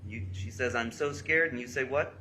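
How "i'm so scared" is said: loud, higher emphatic read-aloud; raised-voice reading of a scared text; imitated alarm. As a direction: read her fear out forcefully. Reads girl's scared texts aloud.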